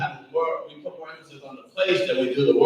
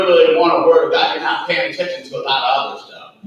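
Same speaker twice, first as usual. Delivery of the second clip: ordinary talk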